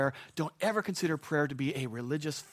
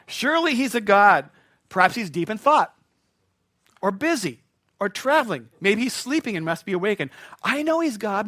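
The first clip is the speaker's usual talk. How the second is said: mock-earnest, somewhat louder teasing; taunting; 5 semitones higher, 8 dB louder than usual. Elijah mocking the false god's silence, a touch louder and teasing. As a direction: poke fun with fake sympathy. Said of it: "Elijah began to taunt them".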